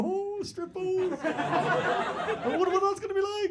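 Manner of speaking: high-pitched, drawn-out mock excitement; excited stag. Stag hears about the strip boat, mock excited. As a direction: imitate a giddy groom, slow and high. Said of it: "stag was quite excited about said strip boat".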